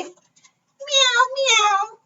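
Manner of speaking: very high, drawn-out meows; cat meow. Acted. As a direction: mew like a storybook cat. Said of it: "cried the cat".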